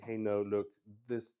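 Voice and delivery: normal talking voice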